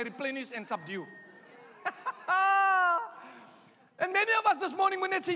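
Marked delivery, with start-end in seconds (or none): 1.8-3.2 laughing. Real, not acted. Laughs at own point.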